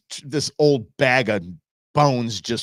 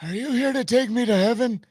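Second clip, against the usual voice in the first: mock Biden high; 8 semitones higher than usual.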